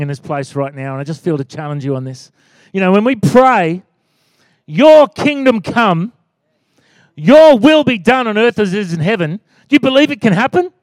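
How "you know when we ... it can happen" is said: loud, raised declaration of prayer; full-force preaching. Real, not acted. Pastor challenging the church with the Lord's Prayer, forceful preaching. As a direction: proclaim it, then challenge the congregation.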